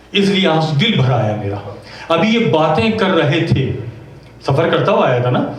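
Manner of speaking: ordinary talk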